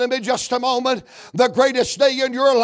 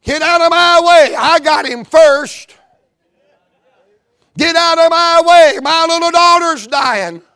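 high, loud angry yell at the crowd; desperate shove; 8 semitones higher, 8 dB louder than usual. Acted. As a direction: bark them out of the way. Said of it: "I'd have started busting me some heads".

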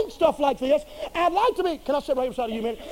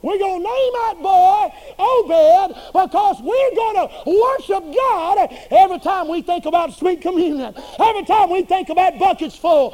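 preaching at full force; 6 semitones higher, 5 dB louder than usual. Acted. Preacher stirring up the congregation, shouting. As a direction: drive it home like a revival preacher.

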